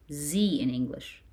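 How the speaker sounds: ordinary talk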